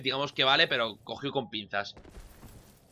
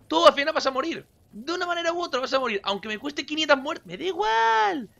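dramatic taunt; 14 semitones higher, 4 dB louder than usual. Acted. Taunting the boss mid-fight.

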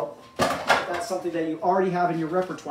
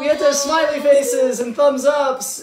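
excited shout; 6 semitones higher, 7 dB louder than usual. Real, not acted. Cheering on a live video.

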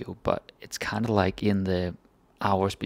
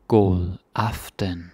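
God aften slowly, god stressed, falling end; slow demo; much slower than usual. Shown as a demonstration. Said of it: "slow demo".

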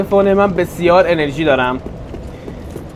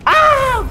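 yelp; much slower than usual. Real, not acted. Sudden hit in game.

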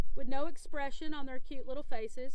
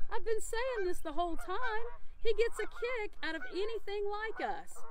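put-on higher eager boy; boy's voice; 7 semitones higher, 3 dB quieter than usual. A narrator's reading. Boy tells Grandpa about the monkey, higher eager voice. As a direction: speak up bright like a keen boy.

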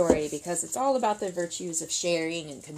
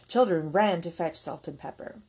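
usual speaking voice

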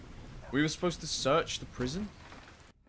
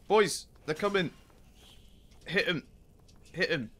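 calling out; 5 semitones higher, 4 dB louder than usual. Real, not acted. Calling to companions in fight.

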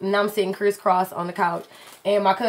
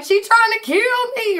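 mock panicked; 12 semitones higher than usual. Cousin telling her boyfriend, in higher mock alarm. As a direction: squeal it like she's scared for her life. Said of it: "she like".

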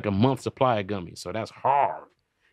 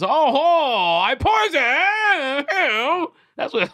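high, wailing, drawn-out fake sob; mock crying killer; 5 dB louder, much slower than usual. Mocking the poisoner in a high fake sob. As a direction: ham up a blubbering confession.

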